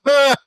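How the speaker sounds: a sharp high "Ah!", loud and short; mock grunt of disapproval. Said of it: "he'd be like".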